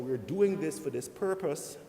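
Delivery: normal talking voice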